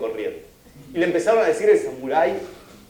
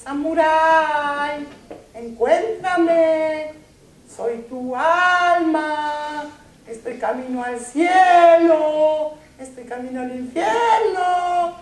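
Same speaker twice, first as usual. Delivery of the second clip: high, very slow, drawn-out ghostly voice; eerie inner voice; 11 semitones higher, much slower than usual. A narrator's reading. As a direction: call out slowly like a distant soul. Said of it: "Una voz que le decía". (voz de alma lejana, aguda y muy lenta)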